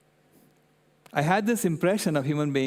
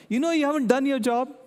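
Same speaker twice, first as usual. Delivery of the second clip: much higher, louder, mock scolding; accusing child; 11 semitones higher, 3 dB louder than usual. Granddaughter accusing grandpa, in a mock indignant child voice. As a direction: call him out like an indignant kid. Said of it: "She said".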